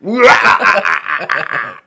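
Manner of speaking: theatrical evil laugh; hammed-up vampire laugh. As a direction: cackle like a mock vampire. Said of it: "Read the joke like Dracula".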